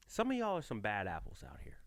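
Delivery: hushed whisper, sharing a secret; a real conspiratorial whisper. Real, not acted. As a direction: lean in and whisper it to fellow drivers. Confiding aside to fellow drivers.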